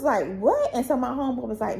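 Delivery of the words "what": shocked what. Retold or imitated. Shocked at aunt's remark.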